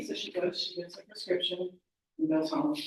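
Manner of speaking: ordinary delivery, nothing marked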